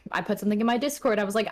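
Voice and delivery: ordinary talk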